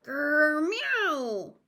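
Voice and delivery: a loud growl, then a rising vexed meow; angry cat growl. A narrator's reading. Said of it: "with an angry".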